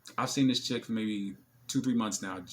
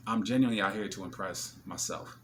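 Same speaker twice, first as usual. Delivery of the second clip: ordinary delivery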